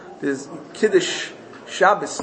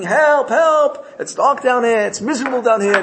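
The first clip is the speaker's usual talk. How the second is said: high, raised mock cry for help; imitated screaming; 8 semitones higher, 5 dB louder than usual. King's workers quoting the slave's cries, mock screaming. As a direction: mimic someone yelling out in misery. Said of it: "The king hears you screaming".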